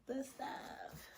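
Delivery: breathy whisper, no voice. Shown as a demonstration. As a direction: whisper it to the camera. Repeating phrase, then whispered.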